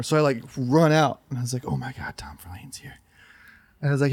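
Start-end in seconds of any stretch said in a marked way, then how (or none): 1.7-2.9 hushed, breathless whisper. Retold or imitated. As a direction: whisper it like a secret thrill. Excited awed aside in store.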